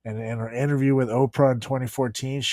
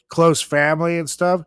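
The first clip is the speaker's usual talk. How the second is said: ordinary talk, nothing marked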